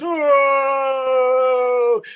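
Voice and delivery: loud, high crowd whoa, mock cheer. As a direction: shout it like a whole amazed audience. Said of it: "the whole audience goes".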